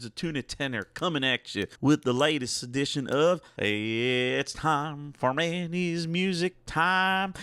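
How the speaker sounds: playful fake Southern accent, stretched out; put-on Southern accent. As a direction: greet the folks like a Southern radio host. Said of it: "switching back between New Jersey and, and, I don't know, some nondescript Southern accent".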